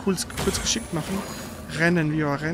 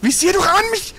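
much higher and louder, fed-up yelling; exasperated shout; 10 semitones higher, 3 dB louder than usual. Real, not acted. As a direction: blow up at her for repeating the mistake. Scolds partner for same mistake.

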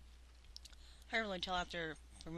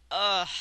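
disgusted groan; 12 dB louder than usual. Real, not acted. Gross taste.

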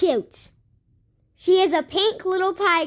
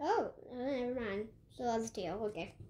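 quiet aside; 9 semitones lower, 16 dB quieter, faster than usual. Real, not acted.

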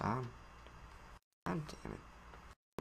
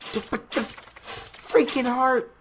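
higher, slow, stretched outrage; outraged cry; 11 semitones higher, 4 dB louder, slower than usual. Real, not acted. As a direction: wail about the heart. Outraged at losing heart.

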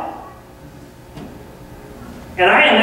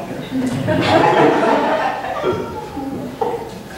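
breathy laugh; 5 semitones higher, 4 dB quieter than usual. Real, not acted. Laughs at own remark.

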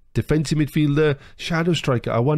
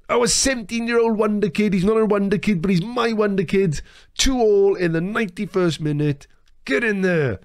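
high, jubilant crowing; thrilled fan after a late goal; elated celebration; 7 semitones higher than usual. Real, not acted. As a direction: gush proudly in a raised pitch. Celebrating his young scorer.

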